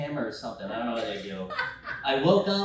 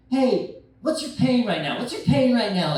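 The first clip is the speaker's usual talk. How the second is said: loud, higher shout in the ear; yelling medic; 7 semitones higher, 9 dB louder than usual. Retold or imitated. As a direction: bark the question at a patient. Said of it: "One guy's yelling in my ear".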